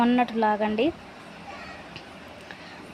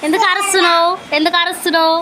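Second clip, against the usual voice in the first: mock scolding; 7 semitones higher, 9 dB louder than usual. Real, not acted. Mother mock-scolds yelling toddler.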